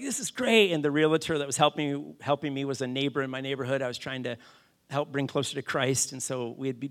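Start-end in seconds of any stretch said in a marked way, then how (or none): 0.0-0.7 high-pitched mock delight; excited. Family chilling in the new house, excited. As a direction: retell it with a happy upward leap. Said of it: "we're excited. We're like".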